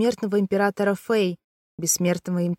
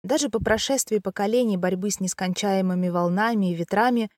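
usual speaking voice

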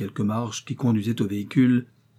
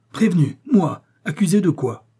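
higher and louder, stunned and offended; spring up in shocked protest; outraged disbelief; 7 semitones higher, 5 dB louder than usual. A narrator's reading. Count jumps up, accused.

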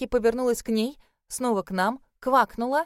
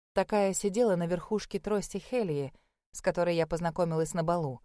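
ordinary delivery

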